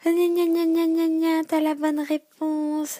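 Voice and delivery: teasing nyah-nyah singsong; high mocking taunt, like a kid. Mocking a rival listener.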